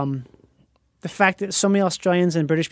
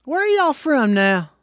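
higher, slow Southern drawl; 6 semitones higher than usual. Acted. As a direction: ask it like a saloon local. Joins the Old West saloon act.

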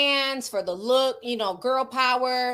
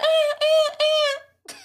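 mock shrug noise; 3 dB louder than usual. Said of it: "It's like".

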